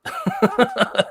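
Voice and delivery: real laugh trailing off; high laugh. Real, not acted. Still amused at his own accent attempt, a high laugh. Laughs again after the remark.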